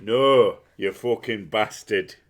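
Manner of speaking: loud, drawn-out Yorkshire cursing; hammed-up Yorkshire outburst; mock Yorkshire accent. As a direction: curse in a broad Yorkshire accent. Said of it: "whenever I go Yorkshire".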